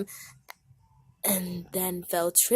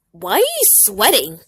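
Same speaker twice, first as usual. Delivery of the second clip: suspicious demand; 10 dB louder than usual. Acted. Blackberry questions Pap.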